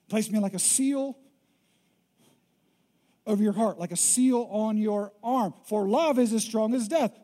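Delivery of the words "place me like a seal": ordinary talk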